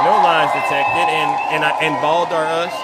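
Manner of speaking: loud, punchy host shout; booming talk show host callout; loud hype callout. Real, not acted. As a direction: call it out to the whole room.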